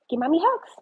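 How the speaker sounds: sweet pet voice. Real, not acted. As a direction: coax the dog up high. Baby talk to dog.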